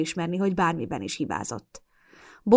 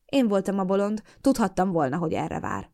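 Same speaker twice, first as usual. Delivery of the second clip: ordinary delivery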